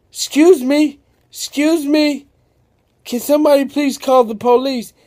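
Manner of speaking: high, loud, fast panicked call; yelling for help. Acted. Sammy calls everyone in the street for help, yelling high. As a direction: shout to the street for the police. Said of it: "as Sammy yells".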